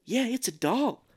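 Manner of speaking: high-pitched comic imitation of an actor; puzzled, sing-song high voice; Mark Wahlberg. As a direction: wonder aloud in a silly high register. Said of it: "and he's like".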